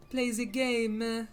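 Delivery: slow mock European accent; put-on European accent. Streamer told to play the game, slow mock European accent. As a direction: draw it out in a hammy European accent. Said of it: "Stop doing accents".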